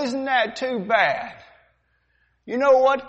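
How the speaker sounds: mocking sneer at the captain; defiant sailor. Sailor defying the captain, mocking sneer. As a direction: talk back to the captain with contempt. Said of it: "The demonstration".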